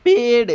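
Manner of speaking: "पेड़" said slowly and clearly as the English word paid; a teacher's model word: "पेड़"; pronouncing paid. Shown as a demonstration. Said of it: "इसकी उच्चारण होगी". (उच्चारण सिखाते हुए "पेड़" साफ़ बोलना)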